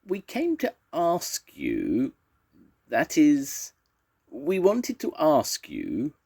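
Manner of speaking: high, slow, halting little voice; timid hesitation. A narrator's reading. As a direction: creep nervously up to the request. Timid Basil stumbles over request.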